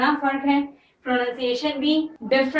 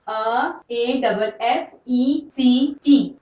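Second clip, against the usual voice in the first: slow spelling out; much slower than usual. Shown as a demonstration. Teacher dictating spelling to class, slowly letter by letter. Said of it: "note कीजिए".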